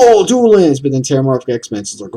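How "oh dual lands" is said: high, loud mock gush; over-the-top fanboy swoon; mocking quote. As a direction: parody fans raving about dual lands. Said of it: "everybody's like".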